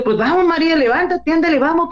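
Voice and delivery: eager father. Retold or imitated. Father now hurrying his daughter to church, eager. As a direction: wake her up, keen to go. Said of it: "mi papá, pues, entonces".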